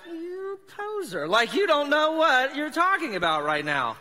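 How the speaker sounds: high, slow mocking "you poser"; imagined scorn. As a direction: sneer it like a judging cashier. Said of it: "he was looking at me like".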